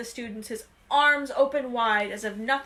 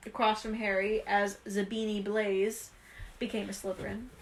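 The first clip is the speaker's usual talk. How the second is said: ordinary delivery, nothing marked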